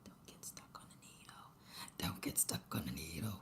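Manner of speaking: very soft voiceless whisper; whispering to oneself. Real, not acted. Crafter reminding herself while stitching, hushed whisper. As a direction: whisper it under your breath like a reminder.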